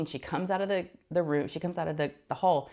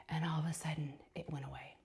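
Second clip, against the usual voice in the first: hushed, much softer whisper; 2 semitones lower, 11 dB quieter than usual. Real, not acted. Telling listeners how it suddenly stopped, whispered. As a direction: drop to a whisper for the reveal. Dramatic moment symptoms vanish.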